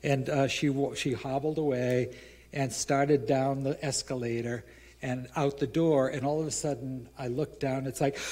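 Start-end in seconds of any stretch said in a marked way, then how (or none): none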